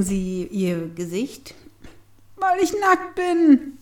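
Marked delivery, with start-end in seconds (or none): 2.4-3.8 high, slow whining voice; much higher, drawn-out whine; whiny. A narrator's reading. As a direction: whine it like a sulky child. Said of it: "in einem quengeligen Tonfall".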